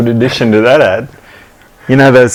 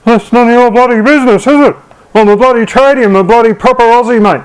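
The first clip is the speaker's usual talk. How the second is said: much higher, snippy ocker voice; snippy Scotty Cam; 7 semitones higher than usual. Retold or imitated. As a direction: bite back like a defensive tradie. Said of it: "Scotty gets all snippy and".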